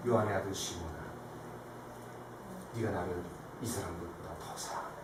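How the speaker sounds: very low, soft voice; sinking softly almost to nothing; hushed, intimate question. Acted. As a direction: ask him quietly, close and personal. Jesus gently questions Peter afterwards.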